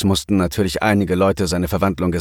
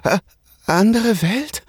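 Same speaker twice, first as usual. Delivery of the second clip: hesitant Äh then a much higher question; stammering; 14 semitones higher than usual. A narrator's reading. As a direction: stumble out the baffled question. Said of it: "stotterte Aya".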